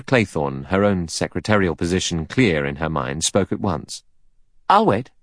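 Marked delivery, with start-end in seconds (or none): 4.7-5.1 high, lightly commanding offer; a touch of authority; slight command. A narrator's reading. Secretary offers to stay behind, slight command. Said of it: "slight suggestion of command in it".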